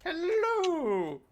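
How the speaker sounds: drawn-out high hello; high calling-out. Real, not acted. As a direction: call it out high and stretched. Calling out on return.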